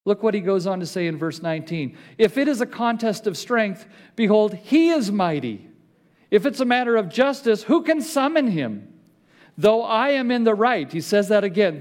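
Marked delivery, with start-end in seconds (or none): 2.2-10.9 rising, louder preaching read; preaching scripture. Acted. Pastor reads Job's protest to the church, forceful.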